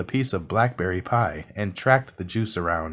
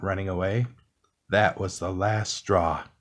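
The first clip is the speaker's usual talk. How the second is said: usual speaking voice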